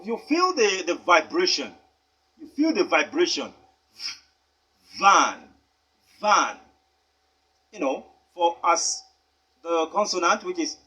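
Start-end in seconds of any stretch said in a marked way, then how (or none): 5.0-6.6 Van said with a buzzing V, louder; V sound. Shown as a demonstration. Teacher to pupils, buzzing the V in Van. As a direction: let the V in Van vibrate in the throat. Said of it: "the V sound".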